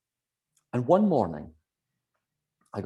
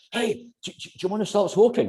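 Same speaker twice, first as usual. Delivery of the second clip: much higher, bright pushy offer; hammed-up eager offer to a friend; eager beginner; 12 semitones higher, 3 dB louder than usual. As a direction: pester a friend like a keen newbie. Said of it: "You're like".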